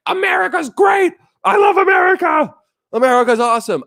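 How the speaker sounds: high-pitched gushing yell; mock fanatical shout. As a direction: shout like an overexcited America fan. Parody of gushing YouTube foreigner.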